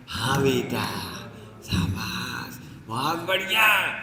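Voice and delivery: a hint of a proud mother approving; pleased mother. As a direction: praise the child gently like a fooled mom. Mother praising her son.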